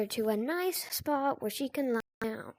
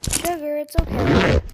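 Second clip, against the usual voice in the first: louder reassuring call to the dog; 9 dB louder than usual. Real, not acted. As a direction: soothe the crying dog from afar. Calming crying dog.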